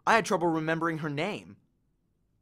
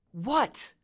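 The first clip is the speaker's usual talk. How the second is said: put-on annoyed girl; 7 semitones higher than usual. A narrator's reading. Annabeth annoyed, distracted.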